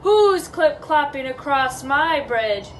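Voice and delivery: slow, booming troll challenge; understated storybook roar; troll's roar. Acted. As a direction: draw it out as a menacing roar from below. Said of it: "A roar rose up from below".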